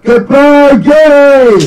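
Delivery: high, loud drawn-out yell; big mock-dramatic cry; shouted goodbye. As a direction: holler it out theatrically. Reads the dramatic goodbye line.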